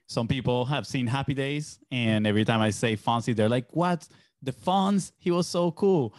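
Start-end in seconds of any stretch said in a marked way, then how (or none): 3.7-6.1 high-pitched, starstruck retold exclamation; excited mock reaction. People hearing the name Fonzie react to him, excited imitation. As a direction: imitate amazed fans, pitched well up.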